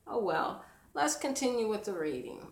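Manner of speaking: usual speaking voice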